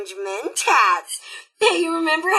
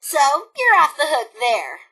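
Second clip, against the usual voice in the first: usual speaking voice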